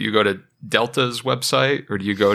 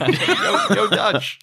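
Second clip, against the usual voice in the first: laughing call; 8 semitones higher, much slower than usual. Acted. Greeting Dutch while laughing.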